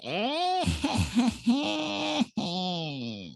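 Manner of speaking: long, high-pitched imitated cackle; creature's laugh. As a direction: cackle like a tormenting gnome, drawn out. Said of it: "they could hear it laughing".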